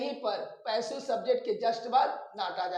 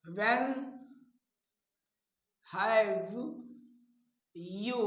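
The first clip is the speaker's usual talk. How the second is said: slow dictation; 4 dB quieter, much slower than usual. Shown as a demonstration. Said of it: "dictating word by word".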